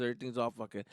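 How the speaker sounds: normal talking voice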